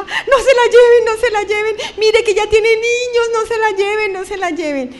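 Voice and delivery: high, loud crying that fades at the end; sobbing plea. Retold or imitated. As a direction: beg them not to take her, then trail off. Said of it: "y empujaba". (súplica llorosa y fuerte que se apaga)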